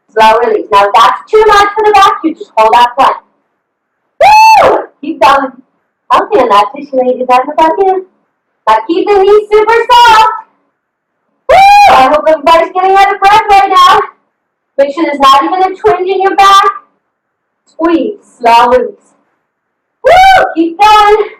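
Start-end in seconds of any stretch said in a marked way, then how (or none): 4.2-4.9 high whooping cheer. Real, not acted. Coach cheers the ladies through the plank with a high whoop. 11.5-11.9 high whooping cheer. Real, not acted. Coach cheers the ladies through the plank with a high whoop. 20.0-20.4 high whooping cheer. Real, not acted. Coach cheers the ladies through the plank with a high whoop.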